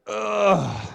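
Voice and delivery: loud, high groan of hurt; pained groan. Acted. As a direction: groan as if punched in the guts. Reliving the teacher's stinging remark.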